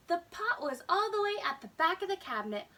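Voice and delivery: ordinary delivery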